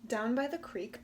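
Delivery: ordinary delivery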